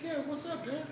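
very soft, high daughter voice; mock daughter. Daughter inviting dad's question, softly. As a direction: answer small and high. Daughter answering her father.

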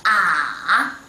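ah drawn out, down first and then up; dipping third tone. Shown as a demonstration. Teacher demonstrating third tone, falling-rising ah. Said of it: "Down first and then up".

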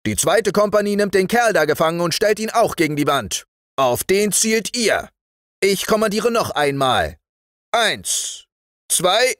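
high-pitched drill voice; commanding sergeant. Acted. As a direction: direct the firing squad. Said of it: "kommandierte der Wachtmeister".

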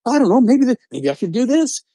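your doubting inner thoughts, voiced high and hesitant; wondering inner voice. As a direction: mull it over in a high, unsure voice. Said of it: "we're like".

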